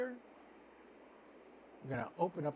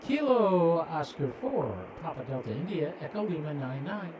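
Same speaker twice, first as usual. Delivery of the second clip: radio call; 4 dB louder than usual. Real, not acted. Calling the ISS on radio.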